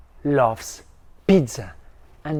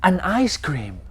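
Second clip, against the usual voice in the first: usual speaking voice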